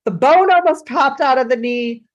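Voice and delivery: loud, breathless mock panic; a child hamming up a scrape; dramatic child.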